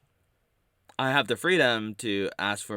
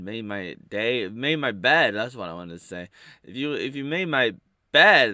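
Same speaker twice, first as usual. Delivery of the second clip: usual speaking voice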